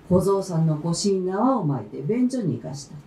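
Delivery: ordinary delivery, nothing marked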